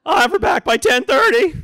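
put-on overly polite boy meeting the girl's father; nervous teenager. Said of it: "I'm like".

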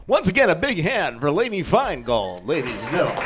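booming radio host intro; radio announcer voice. Pretend. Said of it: "I'm practicing my radio voice".

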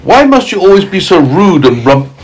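lightly voiced earthworm scolding; a hint of a stern earthworm. A narrator's reading. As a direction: earthworm telling off the centipede. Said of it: "That's the earthworm speaking".

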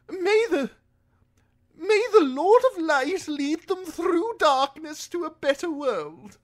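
high, loud, pious highborn lord; Lord Alistair. A narrator's reading. Lord offers prayer for Davos's dead sons, pious. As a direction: intone a blessing in a high aristocratic voice.

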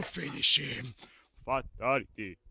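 low, deep announcer voice; deep game announcer. Retold or imitated. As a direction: boom it out like a fighting-game announcer. Mortal Kombat announcer quote.

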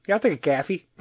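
exaggerated Chicago vowel on "coffee"; Chicago accent. Chicago host accepting the coffee, exaggerated Chicago accent. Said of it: "you know, Chicago accent".

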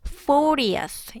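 "fortieth" with strong "for"; first-syllable stress. Shown as a demonstration. Teacher showing how it is pronounced, "fortieth" stressed on "for". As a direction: stress "for", let "tieth" fall away in "fortieth". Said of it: "이렇게 발음을 하고".

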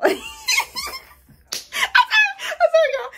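high, loud through laughter; sorry y'all, giggling; laughing apology. Real, not acted. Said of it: "I'm literally smiling and laughing".

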